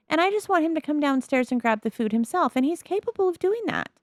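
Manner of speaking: climbing to a high-pitched complaint; exasperated. Real, not acted. Gamer insisting the toddler can get food himself, exasperated. Frustrated that Sims ignore her plan.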